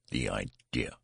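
ordinary delivery

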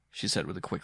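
usual speaking voice

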